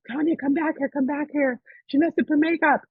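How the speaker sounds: high-pitched acted summons; imitated urgent calling; calling someone back. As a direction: call out in a high, put-on voice. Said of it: "they call my friend Tanya".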